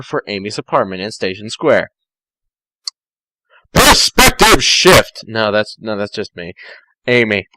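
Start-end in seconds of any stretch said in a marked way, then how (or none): 3.7-5.2 dramatic announcement. Acted. Reader announcing a section header.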